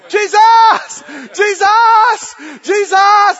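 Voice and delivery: loud, high cries of "Jesus"; shouting out. Retold or imitated. Blind Bartimaeus shouting to Jesus, loud and high. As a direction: shout for him across the crowd. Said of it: "his lungs and voice were working just fine".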